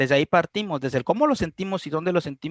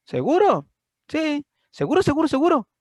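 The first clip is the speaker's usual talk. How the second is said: higher, louder insistent questioning; insistent pressing; 11 semitones higher, 5 dB louder than usual. Acted. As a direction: press harder with every "seguro". Pressing questions, louder each time. (insistente, más agudo y fuerte)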